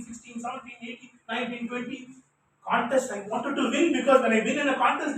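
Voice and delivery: ordinary talk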